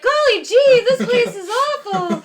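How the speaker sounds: high, loud, laughing golly-gee voice; hammed-up cheerful sitcom family, laughing; wholesome 1950s kid. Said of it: "talk, like, Leave It to Beaver family".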